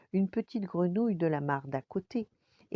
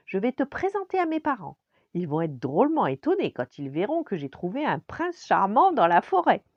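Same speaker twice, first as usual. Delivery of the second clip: high, loud, swooping princess voice; bossy, excited princess; 4 semitones higher, 8 dB louder than usual. A narrator's reading. Princess ordering the frog to meet her parents, excited. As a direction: crow about it in a high princess voice. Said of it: "lui ordonna la petite princesse".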